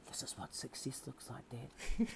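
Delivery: soft breathy whisper in the ear. Retold or imitated. Son hugging dad before rugby, whispered into his ear. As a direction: breathe it close to the ear, voice gone. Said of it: "he'd just say to me in my ear".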